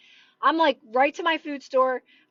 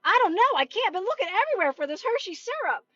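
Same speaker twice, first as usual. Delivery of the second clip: higher-pitched, exasperated imitation; put-on frustrated complaint, pitched up; exasperated shopper; 6 semitones higher, faster than usual. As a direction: mimic a shopper at his wits' end. Acts out frustrated shopper searching.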